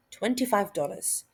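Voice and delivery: normal talking voice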